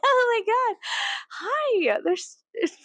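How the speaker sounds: high, excited mock greeting; gushing welcome. Host greeting a fellow rebel, gushing high welcome. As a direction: squeal hello to a new arrival. Acting out welcoming like-minded people.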